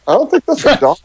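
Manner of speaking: high-pitched mock doubting owner; doubtful dog owner. Imagined rival owner eyeing the disguised monkey, mock doubtful. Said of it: "other owners were like".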